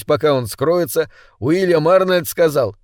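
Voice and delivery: plain reading voice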